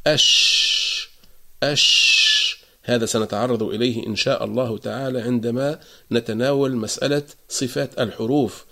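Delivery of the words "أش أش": أش hissed out long, air streaming over the tongue; spreading shin. Shown as a demonstration. Teacher showing students the open shin. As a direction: let the ش in أش flow out. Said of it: "فلما نقول".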